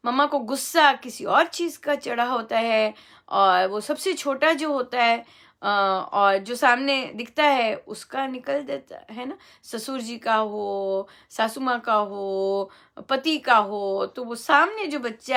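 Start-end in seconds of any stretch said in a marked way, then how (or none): none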